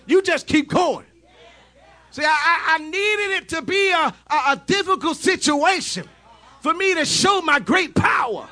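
high, loud shouted sermon; fiery revival preacher, shouting the climax; full-force preaching. Acted. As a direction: preach it out to the crowd at the top of your voice.

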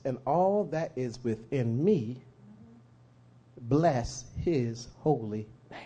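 slow, deliberate psalm reading; scripture reading. Acted. Preacher reads Psalm 103 slowly to the congregation. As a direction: read it with reverence. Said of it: "reading the psalm slowly".

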